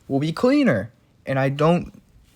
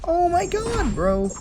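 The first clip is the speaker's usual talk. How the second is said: high shocked exclamation; slower than usual. Real, not acted. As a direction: react in disbelief, pitch jumping up. Shocked reaction to game moment.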